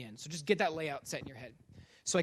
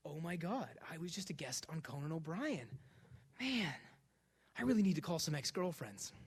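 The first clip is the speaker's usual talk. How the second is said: awed whisper; 9 dB quieter than usual. Retold or imitated. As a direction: whisper it to yourself in disbelief. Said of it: "I'm just like".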